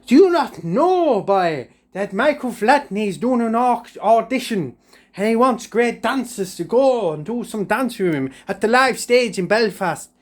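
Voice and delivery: a high nagging granny tells the news; Granny's voice. Retold or imitated. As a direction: announce it in a high scolding voice. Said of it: "He says".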